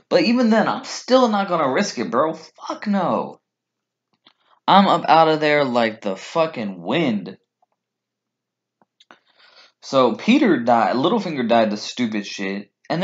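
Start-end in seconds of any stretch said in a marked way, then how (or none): none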